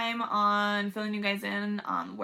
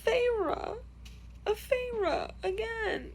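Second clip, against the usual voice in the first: teary; 2 semitones higher, 3 dB quieter than usual. Real, not acted. Reader tells viewers about a sad scene while crying. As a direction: let the tears break through the words. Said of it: "I literally have tears in my eyes".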